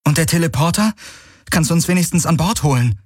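high-pitched urgent plea; pleading heroine. A narrator's reading. Kirai asks the ship to beam them aboard, pleading.

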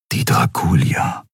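whispered inner thought. Acted. Keru thinking of the flagship, whispered. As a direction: murmur the name to yourself. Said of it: "dachte Keru".

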